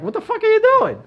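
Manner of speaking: high, loud, disbelieving outburst; incredulous business guy. Retold or imitated. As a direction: blurt it out like a shocked businessman. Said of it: "any business guy would tell you".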